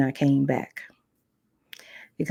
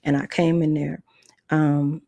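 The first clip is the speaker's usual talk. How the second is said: ordinary talk, nothing marked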